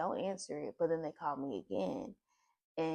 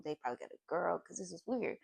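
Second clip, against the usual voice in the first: usual speaking voice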